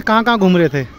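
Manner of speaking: normal talking voice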